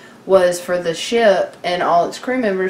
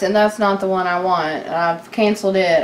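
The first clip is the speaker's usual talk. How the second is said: ordinary delivery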